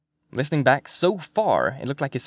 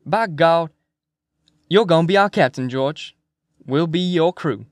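loud, higher hearty proclamation; McMurphy, rousing; 4 semitones higher, 5 dB louder than usual. Acted. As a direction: appoint a captain with gusto.

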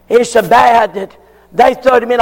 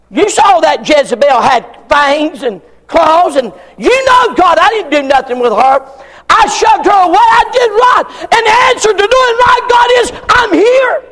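higher, louder, shouted sermon build; slowing into a shouted peak; preaching at full force; 8 semitones higher, 3 dB louder than usual. Acted. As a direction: climb to the climax, each phrase hammered.

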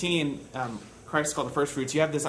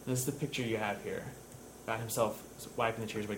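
ordinary delivery

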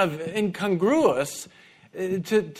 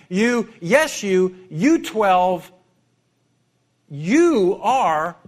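every "you" punched louder and slow; stress on you; 5 dB louder, much slower than usual. Retold or imitated. Jesus singling out the twelve, stressing "you". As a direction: lean hard on each "you". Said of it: "The you is emphatic, it's stressed".

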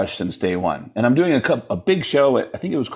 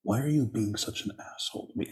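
[name]; 12 dB quieter, faster than usual. Retold or imitated. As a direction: imitate a rock star quietly needling his bandmate. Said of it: "And he goes".

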